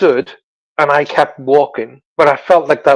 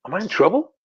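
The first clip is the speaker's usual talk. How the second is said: higher, softer, rising question; worried child's question; 5 semitones higher, 5 dB quieter, faster than usual. Retold or imitated. Child nervously asking mother about the stranger, timid. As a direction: ask it small and unsure, lifting at the end.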